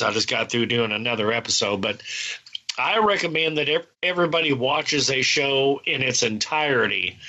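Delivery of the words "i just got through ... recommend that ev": ordinary delivery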